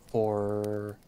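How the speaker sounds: breaking voice on one word; voice cracking. Acted. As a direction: let the voice crack. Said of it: "My voice cracks".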